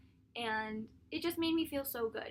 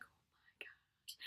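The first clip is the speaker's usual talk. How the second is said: slow whispered gasp into a drawn-out question; dazed awe as the wand chooses her; awestruck whisper; 23 dB quieter, much slower than usual. Retold or imitated. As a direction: breathe it out in wonder. Awestruck as wind blows up.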